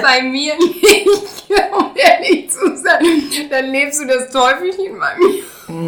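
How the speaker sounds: high, slow, through laughter; laughing it out, barely getting words through. Real, not acted. Laughs at partner's remark.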